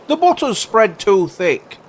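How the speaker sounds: high, loud, peevish voice; grumbling carpenter. Acted. Carpenter caring only about his butter, peevish. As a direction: whine about the food loudly.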